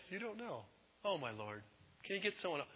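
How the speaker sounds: soft, mildly disrespectful whine; offhand mock protest; flippant. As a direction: imitate a glib excuse, quietly. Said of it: "kind of flippant and disrespectful".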